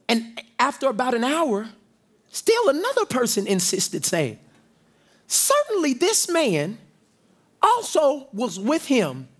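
ordinary talk

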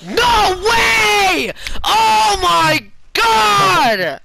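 loud, high, stretched out "oh my god"; excited shout. Real, not acted. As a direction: yell it in pure disbelief. Big gameplay moment.

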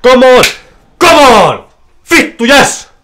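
high, loud ecstatic yell at the win; excited shouting. Real, not acted. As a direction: scream it out in real joy. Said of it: "Anteeksi, mä huudan".

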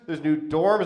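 usual speaking voice

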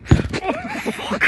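high panicked cry; panicked yelp. Real, not acted. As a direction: yelp as you tip. About to flip over.

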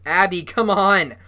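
loud, slowed, exasperated plea; urgent shout. A narrator's reading. Brother begs his sister to let go, shouting. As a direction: shout it, drawing the words out.

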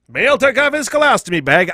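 put-on old-timey radio announcer voice. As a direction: read it like an old newsreel. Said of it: "I don't know why it's a fucking old-timey voice".

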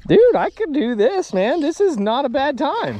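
high, loud, elated exclamation; thrilled. Real, not acted. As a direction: call out high, having a great time. Enjoying a run of catches.

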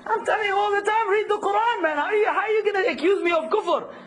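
high-pitched indignant pleading; mock protest. Teacher replays his defence against accusation of kufr, high mock protest.

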